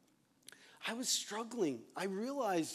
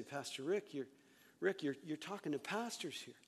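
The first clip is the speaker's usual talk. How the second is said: ordinary talk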